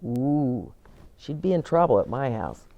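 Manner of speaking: ordinary delivery, nothing marked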